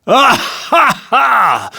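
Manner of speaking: booming, high tyrant's laugh; loud mocking royal laughter; roaring laugh. A narrator's reading. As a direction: roar with cruel glee. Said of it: "roared the latter".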